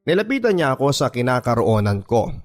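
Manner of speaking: ordinary talk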